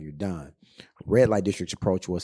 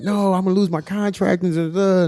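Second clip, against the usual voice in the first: mock distressed girlfriend; 12 semitones higher, 8 dB louder than usual. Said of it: "she's crying".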